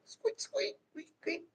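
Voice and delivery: very high, soft mouse squeaks; very high, soft little squeaks; squeaking again. Pretend. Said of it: "Is that a mouse or a rat?".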